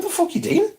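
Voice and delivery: incredulous outburst. Retold or imitated. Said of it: "I was like".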